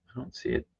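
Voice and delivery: usual speaking voice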